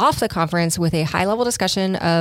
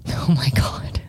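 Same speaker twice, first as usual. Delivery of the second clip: whispered through a laugh; 4 semitones lower, slower than usual. Real, not acted. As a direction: giggle it out under your breath.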